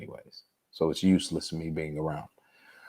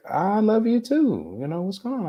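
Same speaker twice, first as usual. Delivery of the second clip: higher-pitched imitation of mom picking up; mother's voice. Son retelling his mother answering the phone, higher imitated voice.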